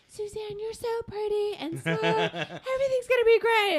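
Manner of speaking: hammed-up gentle cooing, way up high; sugary reassurance. Mocked sweet alternative to Brooklyn reassurance.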